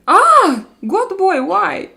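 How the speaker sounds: high, loud, half-joking protest; mock outraged cry. Real, not acted. Reading a viewer's comment, mock outraged high cry.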